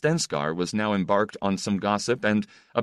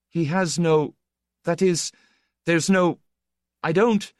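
high, louder, halting false starts; flustered protest; 11 semitones higher, 5 dB louder than usual. Acted. Shy guest balks at meeting his idol, flustered. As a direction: stammer out broken objections in a raised pitch.

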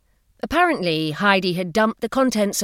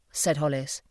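plain reading voice

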